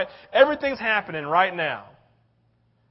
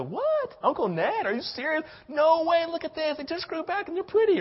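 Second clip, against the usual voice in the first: high, squeaky, disbelieving outburst; shocked protest; 9 semitones higher than usual. Acted. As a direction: mimic the kid's disbelief at Uncle Ned. Disciples as protesting kid.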